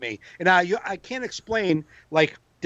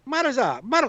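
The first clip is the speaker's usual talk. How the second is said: put-on a little kid's garbled word, high; mock kid babble; 6 semitones higher than usual. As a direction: imitate a toddler saying a strange word. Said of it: "some Arabic word".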